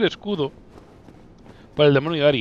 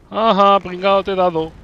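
burla; 6 semitones higher, 7 dB louder than usual. Real, not acted. Acierta al enemigo y se burla.

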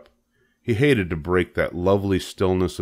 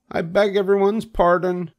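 plain reading voice

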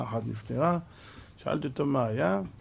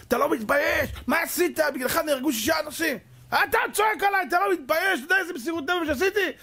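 angry shouting; 12 semitones higher than usual. Retold or imitated. Manager and doctor yelling at each other, high and furious. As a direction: rage at him for his failure. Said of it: "צועק עליו".

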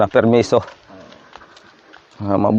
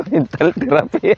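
laughing; 10 semitones higher, slower than usual. Real, not acted. Laughing at own joke.

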